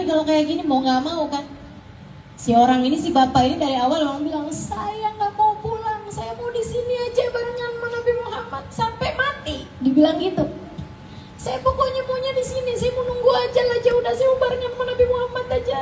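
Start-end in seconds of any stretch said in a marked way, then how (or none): 4.5-9.6 high, softer wailing voice; plaintive refusal. Retold or imitated. Old man's refusal retold. 11.4-15.8 high, hurried pleading; high-pitched rushed whine; plaintive insistence. Retold or imitated. Old man insists on staying.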